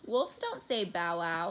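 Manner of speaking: usual speaking voice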